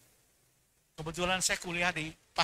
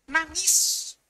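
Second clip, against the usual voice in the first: high, wailing "Nangis" as if sobbing; mock weeping; 9 semitones higher than usual. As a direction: cry the word out in a hammed-up wail. Preacher mimics man weeping.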